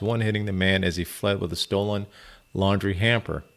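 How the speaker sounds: usual speaking voice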